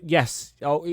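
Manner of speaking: ordinary talk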